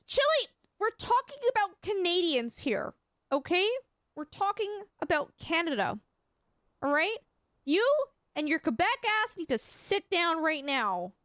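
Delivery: high-pitched, hammed-up telling-off; mock scolding. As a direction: playfully lay down the law, with emphatic pauses. Mock scolding of a Quebec chatter.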